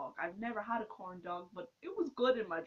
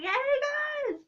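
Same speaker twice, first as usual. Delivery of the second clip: much higher, louder squealy hello; fangirl greeting; 7 dB louder than usual. Retold or imitated. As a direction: gush at your idols like a starstruck fan. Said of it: "I'm all, like, fangirl or whatever".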